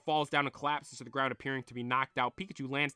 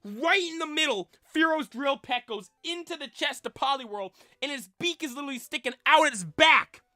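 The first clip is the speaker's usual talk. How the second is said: high-pitched, agitated explaining; worked-up narration; 11 semitones higher, 5 dB louder than usual. A narrator's reading. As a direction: keep the shocked pitch while describing it. Still reacting to the stabbing.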